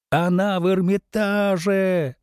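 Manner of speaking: both yell it out together; shouting together. Acted. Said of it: "завопили Петя и Волк хором".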